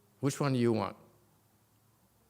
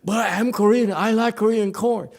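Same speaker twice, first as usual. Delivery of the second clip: high, loud indignant protest; high loud protest, I'm Korean; Sarju's protest; 9 semitones higher, 10 dB louder than usual. Retold or imitated. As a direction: plead your case loudly. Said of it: "poor Sarju said".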